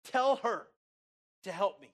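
demanding complaint. Acted. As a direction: order it like an aggrieved sister. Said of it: "she guilt trips the Lord".

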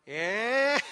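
loud, high mock-taunting cackle; imitating a kid's teasing laugh. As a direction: taunt a sibling with a gleeful laugh. Son teasing brother in car.

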